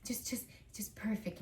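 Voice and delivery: quiet, fussy self-imitation; imitating yourself fussing softly over perfection; hushed perfectionist. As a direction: drop to a soft, barely voiced hush. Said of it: "I'm all like".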